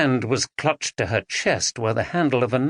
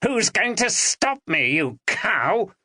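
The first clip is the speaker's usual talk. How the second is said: spat; 9 semitones higher, slower than usual. A narrator's reading. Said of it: "spat Dominic".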